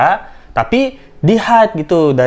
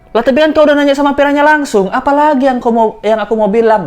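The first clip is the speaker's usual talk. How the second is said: voicing Adit; 10 semitones higher than usual. Voicing Adit's annoyed reply.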